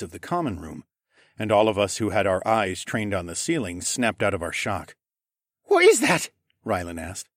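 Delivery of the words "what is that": very high, loud startled voice; alarmed question. A narrator's reading. Rylan reacting to a scream from upstairs, alarmed. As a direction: blurt it out high-pitched in alarm.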